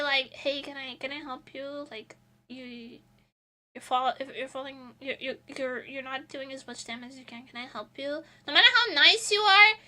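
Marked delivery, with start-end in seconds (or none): 0.3-8.2 soft, friendly offer of help; a hint of sweet healer; polite helpful offer. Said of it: "or if I say".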